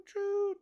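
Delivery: a sudden, very high toot like a toy train whistle; playful train-whistle squeak. Pretend. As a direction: toot it out like a little steam engine. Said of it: "my little engine that could hate is just fucking choo".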